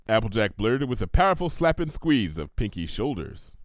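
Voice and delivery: ordinary delivery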